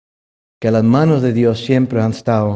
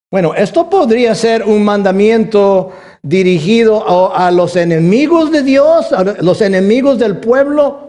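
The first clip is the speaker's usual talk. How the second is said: high, loud pulpit delivery; fervent preaching; 8 semitones higher, 5 dB louder than usual. Acted. Pastor explaining to the church, fervent preaching. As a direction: build it up for the crowd.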